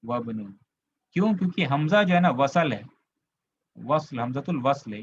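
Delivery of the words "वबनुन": "वबनुन" as one linked word, falling lower; hamza skipped. Shown as a demonstration. Teacher repeating the reading for students, "वबनुन" linked without the i. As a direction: drop the i, saying "वबनुन" low and joined. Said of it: "कैसे पढ़ेंगे आप?".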